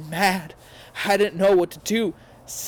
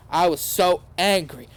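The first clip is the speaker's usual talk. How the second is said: usual speaking voice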